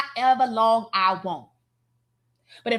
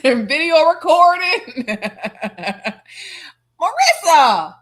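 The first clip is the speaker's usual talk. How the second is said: laughing; 3 semitones higher, 7 dB louder, slower than usual. Real, not acted. Laughing while roasting Marissa.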